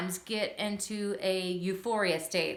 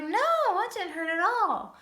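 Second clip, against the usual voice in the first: put-on reassuring child; 10 semitones higher, 4 dB louder than usual. Reassuring son.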